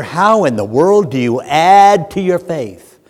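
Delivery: normal talking voice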